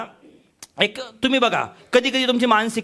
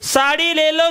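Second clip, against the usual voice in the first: street hawker call; 7 semitones higher, slower than usual. Retold or imitated. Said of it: "बाहेरून आवाज दिला".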